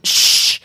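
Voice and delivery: loud, sharp "shh"; sharp shush. A narrator's reading. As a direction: hush the flock at once. Swift hushes the others.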